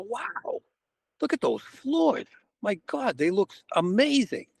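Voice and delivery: high-pitched retold gushing over something; gushing admiration. Agent admires an angry client's floors, gushing. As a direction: act out being wowed, pitch jumping up.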